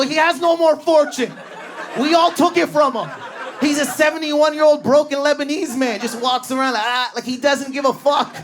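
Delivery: snapping. Retold or imitated. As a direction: nearly snap at her. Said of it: "I almost snapped".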